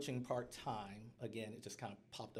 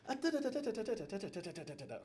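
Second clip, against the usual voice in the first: frantic scribbling noises; 11 semitones higher, faster than usual. Retold or imitated.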